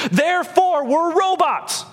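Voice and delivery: high mock conclusion. Pastor mocking a wrong conclusion, high sarcastic.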